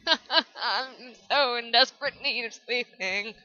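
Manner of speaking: slow, laughing, mock-desperate whine. Real, not acted. Gamer begging for sleep, laughing and whining. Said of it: "I'm so exhausted".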